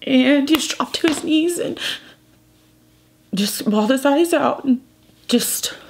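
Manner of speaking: really fighting back tears, halting; choked up. Real, not acted. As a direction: tell it through tears, with long pauses. Tearfully recalls partner grieving over dog.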